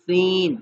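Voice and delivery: "سین" said thin with the teeth together; letter sound demo. Shown as a demonstration. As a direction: clean hissing "سین". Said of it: "اس کو ہم پڑھیں گے".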